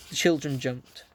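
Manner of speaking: ordinary delivery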